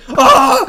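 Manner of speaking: surprised laughing yelp. Real, not acted. Realising he cheesed it.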